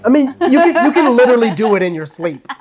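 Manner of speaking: high joking giggle; laughing at own joke. Real, not acted.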